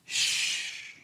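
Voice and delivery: breathy hush to the room. Real, not acted. Pastor hushes his murmuring congregation, voiceless shush. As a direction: quietly shush the crowd.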